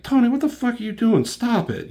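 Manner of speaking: retold high, loud, fast protest; exasperated outburst. Teammate tells Tony to stop it, exasperated high outburst. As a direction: act out an alarmed telling-off, voice shooting up.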